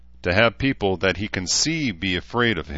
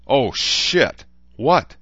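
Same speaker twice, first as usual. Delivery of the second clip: startled, higher and louder cry of alarm; shocked outburst; 6 semitones higher, 6 dB louder, much slower than usual. Acted. Ishtar hears where he was headed, startled. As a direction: jolt into alarm at the news.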